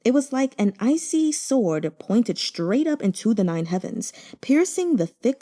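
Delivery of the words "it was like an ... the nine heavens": ordinary delivery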